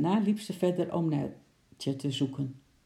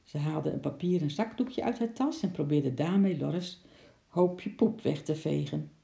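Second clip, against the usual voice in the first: plain reading voice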